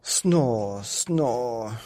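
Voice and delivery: Snore, snore voiced as snores; snoring. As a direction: snore out the words. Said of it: "went Mummy Bear".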